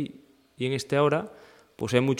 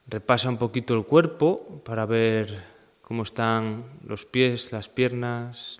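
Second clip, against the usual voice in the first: even, unhurried meditation guide; guided meditation. Acted. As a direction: guide them through the body in level phrases with long pauses. Leads a seated meditation practice. (meditación guiada, pausada y serena)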